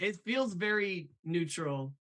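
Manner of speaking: ordinary talk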